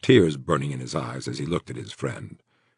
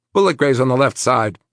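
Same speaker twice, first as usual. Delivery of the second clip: paramedic voice; 8 semitones higher, 6 dB louder than usual. A narrator's reading.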